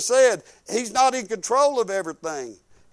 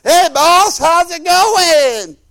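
loud, much higher casual hey boss; cocky loud greeting; 8 semitones higher, 9 dB louder, much slower than usual. Retold or imitated. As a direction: slap the boss on the back with your voice. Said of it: "slap his hat back".

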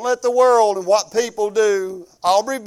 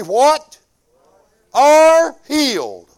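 drawn-out, louder "Are healed" called out; call and response; 4 semitones higher, 7 dB louder, much slower than usual. Acted. Preacher calling the congregation to finish the verse, slow and loud. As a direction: lead the church to answer "Are healed".

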